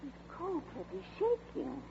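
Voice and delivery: worried murmur to husband; hushed worry. Acted. Worried aside about Vince.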